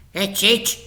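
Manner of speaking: high, eager crook voice; a touch of a thrilled thief; lightly voiced crook. A narrator's reading. Fingers to Thumbs at the gate, eager.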